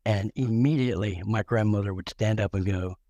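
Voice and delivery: ordinary delivery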